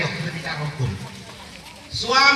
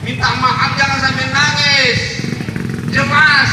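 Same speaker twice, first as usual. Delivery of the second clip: raised, loud preacher's call; emphatic call; 6 semitones higher, 7 dB louder than usual. Real, not acted. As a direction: call it out loud and high to the congregation. Preacher repeats instruction over noise.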